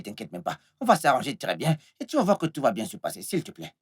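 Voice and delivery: low, soft, placating voice; calm an upset sweetheart gently, keeping it low; hushed coaxing reassurance; soothing reassurance. Acted. Reassures lover, offers car keys.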